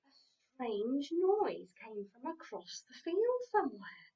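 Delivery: plain reading voice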